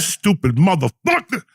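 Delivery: angry. Retold or imitated. Imagined insult to traveler.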